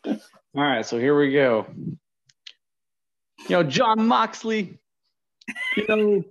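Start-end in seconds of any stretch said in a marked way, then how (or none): 3.5-6.2 put-on wrestling promo in a New York accent. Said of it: "do a promo in a New York accent".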